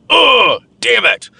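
acted frustration; mock annoyed. Shown as a demonstration. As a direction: demo the same flare of anger. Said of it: "and you go".

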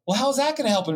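high, puzzled question; mock woman's voice. Husband telling a joke, mimicking the puzzled wife in a high voice. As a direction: pitch way up as the baffled wife. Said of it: "the woman's like".

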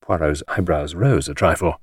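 plain reading voice

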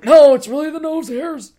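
high, loud hammed-up protest; mock protesting. Boyfriend denying to girlfriend he cried, high mock protest.

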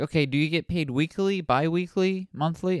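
high, prim clerk voice; mock official asking; bureaucratic questioning. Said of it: "they were like".

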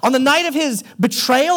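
normal talking voice

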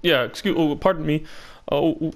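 put-on Canadian accent. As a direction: keep apologizing like a Canadian. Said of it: "sounds like a Canadian".

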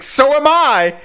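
high squeaky mock reply; Amber's reply. Amber answers Gorblin's confession in a high mock voice. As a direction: pipe it up high as a playful echo. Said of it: "And she's like".

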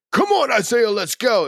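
high, eager rally cry; hyped-up cheer. Shown as a demonstration. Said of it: "It wasn't like".

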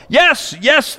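high mock eager cheer; fervent crowd shout. Crowd shouting with fervor to Blondin, high mock cheer. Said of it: "the crowd shouts with fervor".

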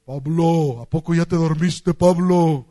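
a loud, booming call across the room. Retold or imitated. Fermín calling to Pablo in the hotel room at night, loud booming call. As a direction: boom it out like a big-voiced old pastor. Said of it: "Tiene así la voz bien fuerte el hermano Fermín".

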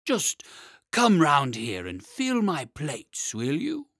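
slow, loud dragon flattery; butter him up in a heavy beast voice; flattering dragon. Acted. Dragon orders the smith.

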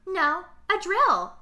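slow, high deadpan; nonchalant. Retold or imitated. Imitating Angela answering the camper, mock nonchalant. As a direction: casually state it like nothing. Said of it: "the delivery of her just, like, so nonchalant".

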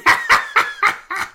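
high breathless cackle; unhinged evil laugh. Pretend. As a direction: cackle like a madman. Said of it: "That's a good one".

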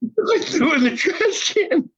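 high-pitched, laughing through the words; laughing confession. Real, not acted. Admits to his friend he threw it away, laughing helplessly. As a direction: crack up while admitting it.

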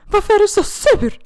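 high, loud crying question; crying down the phone to her boyfriend; tearful wail. Retold or imitated. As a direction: wail it out through tears. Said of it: "började störttjuta".

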